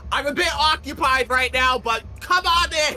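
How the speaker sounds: high, loud call through a door; shopkeeper calling out. Acted. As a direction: call out from the back room, busy.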